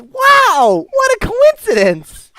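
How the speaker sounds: very high, loud, drawn-out wonder; amazed young boy. Acted. Boy marvelling at shared names, amazed and young. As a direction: gush like an astonished kid.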